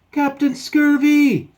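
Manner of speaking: high, very loud, stretched cry; alarmed call. Acted. Shipmate calling to drowning captain, long high shout. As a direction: scream the name across the water.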